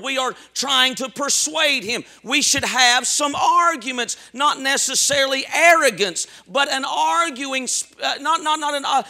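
ordinary talk, nothing marked

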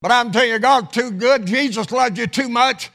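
full-throated pulpit exhortation; impassioned preaching. Real, not acted. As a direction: preach it with rising fervor.

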